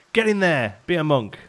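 loud, excited urging; excited cheer. Real, not acted. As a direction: cheer them in. Cheering new villagers into monastery.

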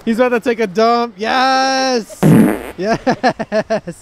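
loud, high gleeful shouts; excited cheering. Real, not acted. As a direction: cheer like your team scored. Dog about to poop, cheering.

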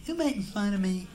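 a lightly voiced high impeded whine; speech impediment voice. Customer with a speech impediment accuses the bartender, mildly. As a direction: ask it with the impediment. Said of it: "a little speech impediment".

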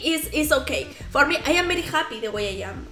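ordinary delivery

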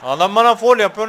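high, loud protest; loud high outraged protest; indignant shout. Real, not acted. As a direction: complain the ref missed it. Protesting foul at referee.

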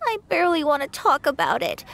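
normal talking voice

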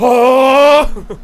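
sudden loud gasp; startled shout. Real, not acted. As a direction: yelp in surprise. Sudden shock.

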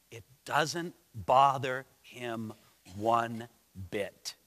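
"it doesn't bother him one bit" low and very slow; low, deliberate word-by-word "one... bit"; emphatic pauses. Real, not acted. Preacher stressing his main point.

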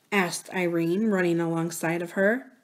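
plain reading voice

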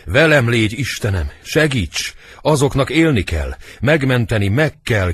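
agitated stage prayer; urgent acted plea. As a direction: plead desperately with heaven. Servant urgently prays to save princes.

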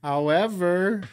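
loud, high, drawn-out foreman call; mock foreman. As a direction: holler like a site foreman giving directions. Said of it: "the foreman, like, coming in".

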